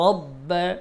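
রব্ব with a full, thick র; heavy ra. Shown as a demonstration. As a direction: round the র in রব্ব. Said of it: "রর উচ্চারণ মোটা হবে". (মোটা র দিয়ে রব্ব)

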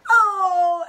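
loud startled oh; sudden oh. Real, not acted. Vlogger catching herself mid-thought, loud oh. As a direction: blurt it out.

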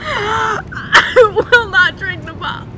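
loud, slow crying refusal; shouting refusal. Real, not acted. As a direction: wail it out in protest.

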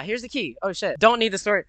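usual speaking voice

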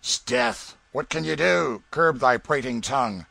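loud, imperious count; angry interruption. Acted. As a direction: cut the prattler off with force. Count cuts off player's boasting.

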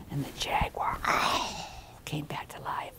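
soft whispered telling; whispered narration. A narrator's reading. Storyteller whispering as the jaguar rises. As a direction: hush the room for the jaguar.

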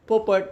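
"पोपट" pitched high; parrot-call tune. Shown as a demonstration. Schoolmaster shows how one calls a parrot, "पोपट" pitched high. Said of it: "म्हणजे आपण म्हणतो ना".

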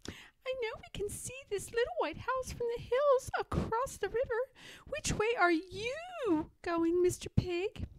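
high, whispery little pig voice; Pigwig's voice. Acted. Pigwig asks Pigling the way, high and whispery. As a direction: lean in, breathy and small.

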